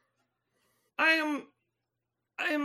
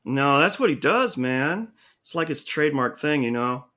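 much lower dude voice; alien-changed guy; 11 semitones lower than usual. Acted. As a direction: drop way down into a laid-back bro. Same low character.

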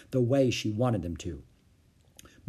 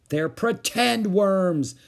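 high, loud, drawn-out retort; exasperated yell; 8 semitones higher, 5 dB louder, much slower than usual. A narrator's reading. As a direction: yell to set her straight. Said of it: "yelled Ramona".

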